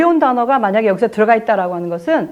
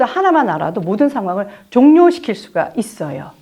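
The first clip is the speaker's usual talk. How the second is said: ordinary delivery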